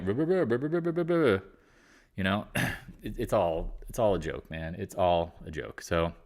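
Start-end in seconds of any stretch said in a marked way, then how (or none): 0.0-1.4 dismissive blah. Real, not acted. Dismissing critics' messages.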